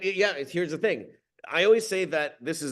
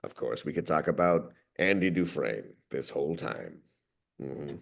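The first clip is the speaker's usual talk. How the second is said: very low, soft, measured voice; deep, unhurried narrator impression; Morgan Freeman; 11 semitones lower, 6 dB quieter than usual. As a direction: drop deep and quiet like a wise film narrator. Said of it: "Morgan Freeman".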